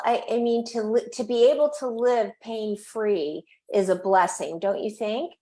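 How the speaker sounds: ordinary talk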